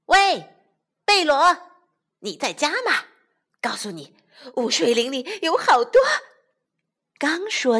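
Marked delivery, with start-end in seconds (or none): 0.1-6.0 loud, high eager call. Acted. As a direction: shout it through the door. Calling at friend's door.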